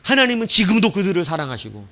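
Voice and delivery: ordinary talk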